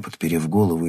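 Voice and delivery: ordinary delivery, nothing marked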